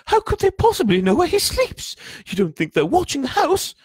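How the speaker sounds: very high, loud, alarmed; Aunt Petunia quivering. A narrator's reading. Aunt fearing they are being watched, quivering. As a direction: squeal your worry at your husband. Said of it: "was saying in a quivering voice".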